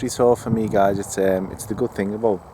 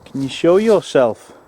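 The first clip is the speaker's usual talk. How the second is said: loud, raised call across the woods; 4 semitones higher, 7 dB louder than usual. Real, not acted. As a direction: shout out to someone hidden, without anger. Calling out to unseen presence.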